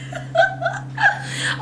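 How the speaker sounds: high, drawn-out squeals breaking into laughter; giddy with real delight, laughing through it; overjoyed. Real, not acted. Fan raving about a favourite scene, real squealing joy. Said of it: "so fucking happy. Can, can you tell?".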